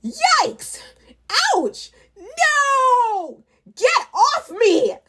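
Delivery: high, loud goblin yelps; yelling goblins. Acted. Goblins crash together on inner tubes, high yelps. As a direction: yelp like goblins tumbling downhill. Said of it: "They were all yelling".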